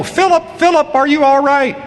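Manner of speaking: high, alarmed cry across the stands; a mother yelling for her injured son; worried calling out. Retold or imitated. Mother in the stands calling out in alarm to her injured son. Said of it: "I see her stand up and go".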